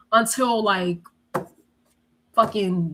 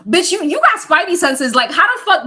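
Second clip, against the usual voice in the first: loud, high-pitched mock astonishment; incredulous amazement; 8 semitones higher, 7 dB louder than usual. Teen to cousin who sensed mom listening, loudly incredulous. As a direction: exclaim it in amused disbelief.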